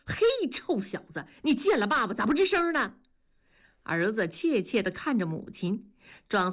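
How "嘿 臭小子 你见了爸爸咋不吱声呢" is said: put-on high, fast hollering at the boy; father hollering at his son, higher and fast. A narrator's reading. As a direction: call out to the kid, rough and teasing. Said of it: "冲着儿子嚷嚷着".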